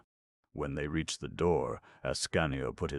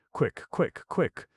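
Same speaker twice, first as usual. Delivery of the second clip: urgent; 12 semitones higher, 4 dB louder, slower than usual. A narrator's reading. Herman urging release.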